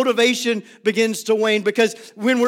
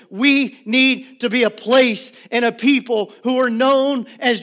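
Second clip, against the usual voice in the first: preaching at full force; 4 semitones higher than usual. Acted.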